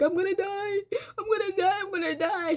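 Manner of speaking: high, crying wail; retold bawling. Panicking at the neighbor's door, crying high that she'll die. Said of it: "I was just bawling".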